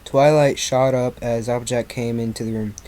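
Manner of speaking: usual speaking voice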